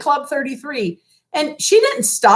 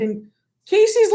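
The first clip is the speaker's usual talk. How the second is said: ordinary delivery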